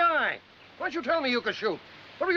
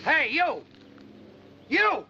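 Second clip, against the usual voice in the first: calling out; 4 semitones higher, much slower than usual. Acted. Calling the shooter over.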